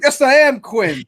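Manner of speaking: slow, a hint of Quinn's voice. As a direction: lightly put on Quinn's voice, slowly. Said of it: "I started doing Quinn's voice".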